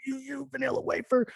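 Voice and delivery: high, soft imitation of someone furious; high-pitched, held-back mock outrage; mock mad. Imagining a player with a strong filter, mock mad. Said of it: "They were really mad and like".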